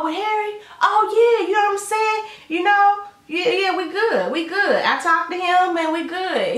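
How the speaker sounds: a light put-on high voice for both; high voiced quotes. Voices Shonda and Willie chat.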